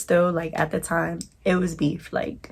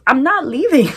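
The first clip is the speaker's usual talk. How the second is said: high, loud defiant yell; 8 semitones higher, 8 dB louder than usual. Retold or imitated. Yelling at her ex through the door, loud and defiant. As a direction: shout it up at the door, refusing to budge.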